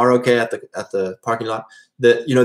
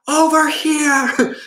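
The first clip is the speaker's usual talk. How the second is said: much higher, slow drawn-out call to the crowd; retold official's shout; much slower than usual. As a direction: bellow it out like a customs officer. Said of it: "shouting at them".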